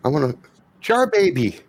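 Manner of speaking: put-on high-pitched little child calling a name; child's voice. Child character Whisper calls out to Reggie, high child voice. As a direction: pipe up like a small child.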